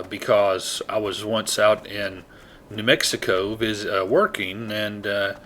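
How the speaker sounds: normal talking voice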